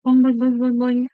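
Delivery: bouncy loud boing noises; boing sound effect. Retold or imitated. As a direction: bounce each boing like a ball. Imitating a bouncing ball.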